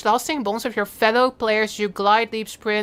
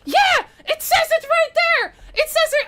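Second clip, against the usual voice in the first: excited shout. Real, not acted. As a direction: shout it out in triumph. Sees in-engine text.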